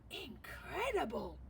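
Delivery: slow, amazed cry of the judges; a touch of hammed-up amazement; excited judges. A narrator's reading. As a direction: marvel at it, drawing it out. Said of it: "cried the judges".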